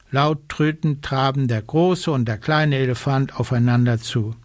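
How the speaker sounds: usual speaking voice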